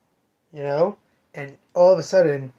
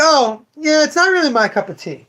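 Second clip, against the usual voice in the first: fake polite; 11 semitones higher, 6 dB louder than usual. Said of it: "So I'm like".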